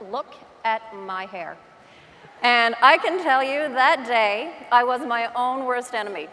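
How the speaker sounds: usual speaking voice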